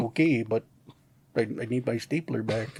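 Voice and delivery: low, quiet mumbling complaint; Milton mumble. Retold or imitated. Milton muttering alone in the storage room, low and quiet. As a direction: grumble it to yourself. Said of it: "he would just be like".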